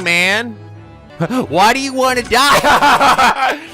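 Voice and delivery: usual speaking voice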